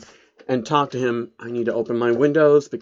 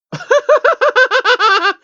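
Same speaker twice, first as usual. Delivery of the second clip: very high, loud incredulous laugh; laughing out of disbelief; high burst of laughter; 6 dB louder than usual. Real, not acted. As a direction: crack up at the sudden ending. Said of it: "Wait a minute, that's it?".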